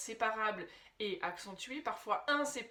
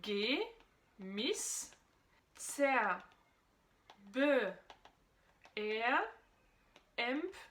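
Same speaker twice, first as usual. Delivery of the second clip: isolated German prefixes, one at a time; slow, spaced-out recital for memorising; syllable by syllable; much slower than usual. Shown as a demonstration. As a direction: list each prefix separately with long pauses. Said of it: "préverbes à connaître".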